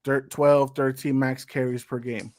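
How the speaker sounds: ordinary talk, nothing marked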